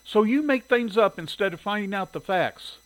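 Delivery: high, loud, accusing disbelief; incredulous. A narrator's reading. Shirley challenging the editor's reasoning, incredulous. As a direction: question it in indignant disbelief. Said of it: "Shirley was incredulous".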